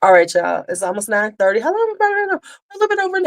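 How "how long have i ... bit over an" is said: surprised. Real, not acted. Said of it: "surprised at the time".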